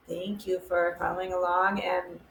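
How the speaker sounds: normal talking voice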